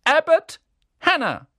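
loud, high roll call. A narrator's reading. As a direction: call the name across the hall. Teacher calls out names. (laut aufgerufener Name)

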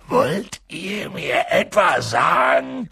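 slow, growled challenge; angry growl. A narrator's reading. Furious giant confronts the cowering relatives, growling. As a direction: growl it at them, drawn out and menacing. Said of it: "knurrte er sie an".

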